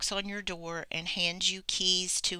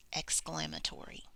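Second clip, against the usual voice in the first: ordinary talk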